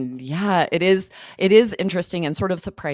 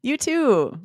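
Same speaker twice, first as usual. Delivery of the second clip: higher, bright partygoer congratulating a friend; cheery party guest; 8 semitones higher than usual. Acted. A guest congratulating a writer at a party, pitched up and cheery. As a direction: sound delighted for her, pitched up. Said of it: "a role play".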